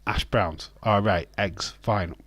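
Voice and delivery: normal talking voice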